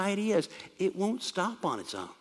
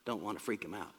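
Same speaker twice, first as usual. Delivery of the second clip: soft, low, hurried mock apology; hushed, fake-considerate excuse; 9 semitones lower, 10 dB quieter, faster than usual. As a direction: pretend to back off meekly, very quietly. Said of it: "The Rabshakeh doesn't say".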